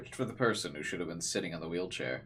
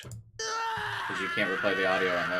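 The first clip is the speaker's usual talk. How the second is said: mock whiny rant. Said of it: "when he learns he can replay audio".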